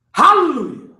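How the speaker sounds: ordinary delivery, nothing marked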